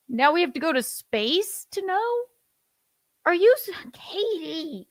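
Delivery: exasperated disbelief; incredulous. Real, not acted. Ranting at the singer's quote.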